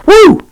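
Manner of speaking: loud, very high "woo"; high whoop. Real, not acted. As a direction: let out a short high whoop. Exclaims while angling camera.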